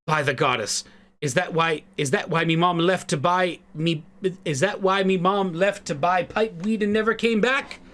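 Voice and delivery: put-on dawning hurt in a light Irish accent. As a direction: realise the awful truth with an Irish lilt. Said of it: "I'm bad at Irish accents".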